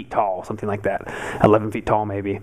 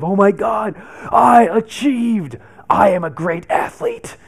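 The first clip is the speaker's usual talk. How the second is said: a loud, higher, slow victorious yell; triumphant shout; 5 semitones higher, 5 dB louder, slower than usual. Retold or imitated. At the top of his first climb, shouting to himself in triumph. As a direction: crow like the king of the world. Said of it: "I had that feeling of".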